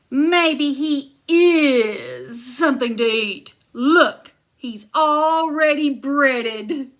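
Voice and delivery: laughing giant. Acted. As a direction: relish the joke like a hungry giant. Giant jokes menacingly.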